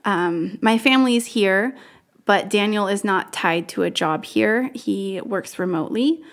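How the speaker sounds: normal talking voice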